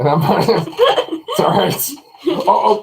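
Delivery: high, laughing protest. Real, not acted. Dad laughing after his daughter talks over him.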